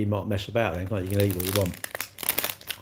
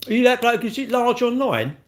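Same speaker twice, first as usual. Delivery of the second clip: high, loud excited stranger voice; mock fan; 11 semitones higher, 3 dB louder than usual. Stranger in real life quizzing an online tough guy, high and loud. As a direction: play a starstruck stranger spotting someone. Imitating a stranger recognising him.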